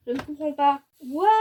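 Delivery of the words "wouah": plain reading voice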